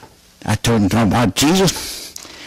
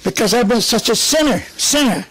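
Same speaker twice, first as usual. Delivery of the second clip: raised, pleading cry to the Lord, lightly voiced; retold tearful plea; 9 semitones higher than usual. Repentant man at the altar pleading with God, high tearful plea. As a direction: plead high, half-retelling his tears at the altar. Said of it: "I cried".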